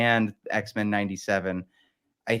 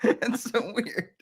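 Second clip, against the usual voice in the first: slow, high, giggly wonder; laughing disbelief; 11 semitones higher, 4 dB quieter, slower than usual. Real, not acted. Podcaster still amazed by the accent, laughing high and slow. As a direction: draw it out through real laughter.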